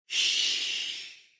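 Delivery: whispered shh; hushing. A narrator's reading. Mother hushes her son, whispered. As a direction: soothe with a breathy hush. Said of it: "she says".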